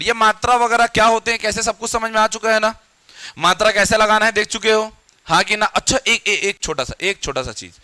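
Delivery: ordinary talk, nothing marked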